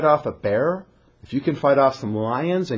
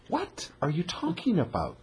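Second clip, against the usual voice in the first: inner puzzled thought; 12 dB quieter than usual. Retold or imitated. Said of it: "Saul's thinking".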